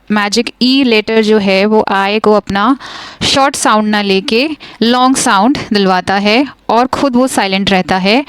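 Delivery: ordinary talk, nothing marked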